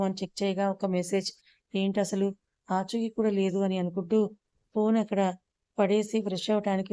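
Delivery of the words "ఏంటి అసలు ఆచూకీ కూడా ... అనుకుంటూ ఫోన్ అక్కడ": ordinary delivery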